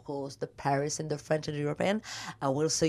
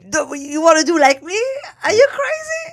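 high, loud incredulous outburst; mock outrage; 13 semitones higher, 11 dB louder than usual. Real, not acted. Guest reacts to host imitating her name, loud mock outrage. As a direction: shriek it in playful disbelief.

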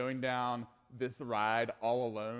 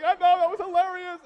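put-on laughing kid; 13 dB louder than usual. Kid answers after the ride.

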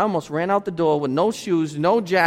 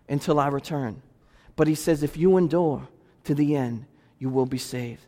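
gentle reassurance; 6 semitones lower, 6 dB quieter than usual. Real, not acted. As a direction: lower and softer, tender promise. Preacher softens quoting Jesus.

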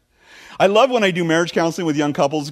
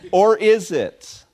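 slow and louder, teasing; dramatic question; 6 semitones higher, 4 dB louder, much slower than usual. Real, not acted. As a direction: let it hang, slowly. Rhetorical dramatic twist.

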